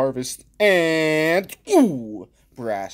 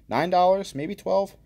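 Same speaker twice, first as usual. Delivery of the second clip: normal talking voice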